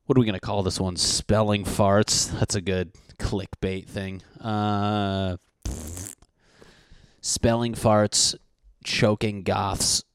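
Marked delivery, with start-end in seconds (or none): 1.0-2.3 slow title announcement. Real, not acted. Proposing an episode title. 7.2-10.0 slow drawn-out list. Real, not acted. Listing title ideas.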